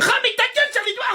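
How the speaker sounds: high-pitched comic protest; indignant retort. Acted. Pupil to classmate who tells, indignant retort. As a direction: fire back with a leap in pitch.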